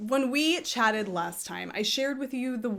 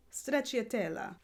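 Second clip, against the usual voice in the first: Italian accent; 5 dB quieter than usual. Shown as a demonstration. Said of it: "calling it that with an accent".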